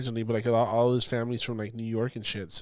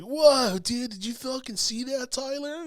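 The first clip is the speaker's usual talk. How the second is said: much higher, hyped-up bro voice; mock the overexcited ghost hunter, pitched way up; 12 semitones higher than usual. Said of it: "I hate all these ones who are like".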